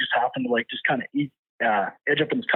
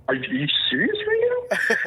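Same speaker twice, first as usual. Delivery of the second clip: mock disbelief; 11 semitones higher than usual. Acted disbelief at wife beside moose.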